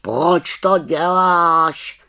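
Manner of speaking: slow, high, odd raven voice; a puzzled raven drawing out the question; raven asking. Acted. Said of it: "zeptal se Abraxas". (pomalý havraní hlas)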